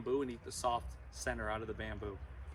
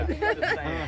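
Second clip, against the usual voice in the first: high laughing aside; 9 dB louder, slower than usual. Real, not acted. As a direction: laugh through the words. Joking about a bad idea.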